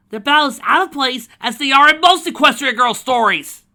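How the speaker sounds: very loud, slow, high ranting yell; screaming frustration, dragging the words; exasperated shout. Acted. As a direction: blow up at the story itself. Fourth-wall rant, shouting.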